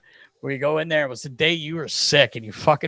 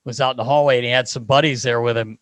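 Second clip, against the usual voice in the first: normal talking voice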